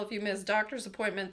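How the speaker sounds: ordinary talk, nothing marked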